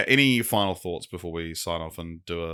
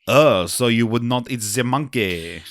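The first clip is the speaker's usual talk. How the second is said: lightly done French waiter; mild French waiter accent; thick French accent; 2 semitones higher, 4 dB louder, slower than usual. Acted. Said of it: "The waiter has a very thick French accent".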